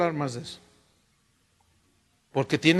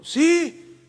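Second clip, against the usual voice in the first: high, louder call to the door; retold call, pitched up; 9 semitones higher, 5 dB louder than usual. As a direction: answer a knock from across the room. Calls out to whoever is knocking.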